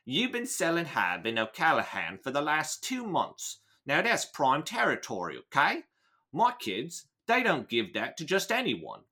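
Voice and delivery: put-on Cockney, lilting upward; amateur London gangster accent with rising okays; Michael Caine. Gang boss lecturing a dealer about territory, mock Cockney. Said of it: "lean a little bit toward Michael Caine".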